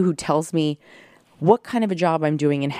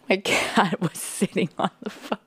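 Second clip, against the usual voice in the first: ordinary talk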